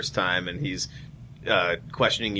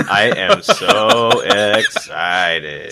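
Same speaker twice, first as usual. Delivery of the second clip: slow, stretched robot voice through laughter; laughing cyborg imitation; 7 dB louder, much slower than usual. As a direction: drag each word out like a robot, laughing. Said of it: "I was trying to sound like a cyborg".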